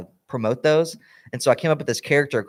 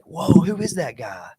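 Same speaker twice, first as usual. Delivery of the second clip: drawn-out, higher-pitched mock amazement; amazed; 5 semitones higher, 3 dB louder than usual. Friend reacting to Hot Rod in the skit, amazed. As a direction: gawk aloud at a stranger, slowly.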